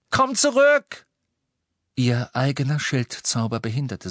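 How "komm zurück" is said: very high, loud scream; desperate cry. A narrator's reading. Hermine screaming after Ron into the night, desperate cry. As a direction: scream it after someone leaving.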